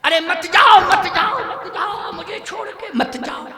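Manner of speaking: high, hammed-up wail fading into a breathy whimper; mock a wife's frantic plea, loud then collapsing. Preacher mimics wife begging her dying husband, mock wailing. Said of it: "उस समय पत्नी दौड़ती".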